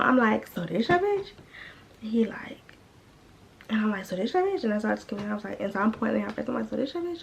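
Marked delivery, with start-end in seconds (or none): 0.5-1.3 high-pitched accusing question; retold challenge, voice swooping up; mock confrontation. Storyteller confronting him about the girl, pointedly accusing. 4.1-4.6 high-pitched accusing question; retold challenge, voice swooping up; mock confrontation. Storyteller confronting him about the girl, pointedly accusing. 6.7-7.2 high, softer accusing question; mock confrontation. Storyteller pointing at the girl's face, pointedly accusing.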